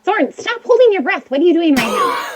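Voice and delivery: high, hurried telling-off; mock-exasperated high outburst; exasperated scolding. Child scolds friend, high pitch.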